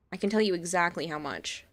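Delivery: ordinary talk, nothing marked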